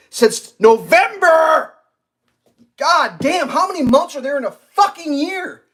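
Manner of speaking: high, loud exasperated outburst; real fed-up shouting; furious rant. Real, not acted. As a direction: vent like you've had it. Ranting about customer service.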